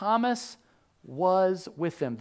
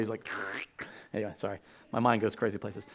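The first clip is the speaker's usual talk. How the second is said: ordinary delivery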